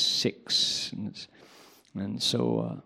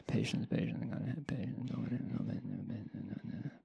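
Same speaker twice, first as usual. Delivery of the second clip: much softer, fading into murmured no's; muttered mantra; 13 dB quieter, faster than usual. Retold or imitated. Teacher calming himself with the mantra, fading to nothing. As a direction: murmur it, trailing away.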